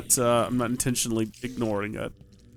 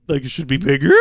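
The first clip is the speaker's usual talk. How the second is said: mock echo; 5 dB louder, faster than usual. Player echoing their own odd phrasing to chat, mocking it. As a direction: repeat it, louder, with "bigger" curling up. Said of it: "I don't know why I said it like that".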